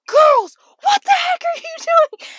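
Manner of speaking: put-on high, loud furious shout at the girls; coach screaming. Coach screaming at distracted girls, furious high shout. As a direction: bellow it across the field like an angry coach. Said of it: "our coach is screaming".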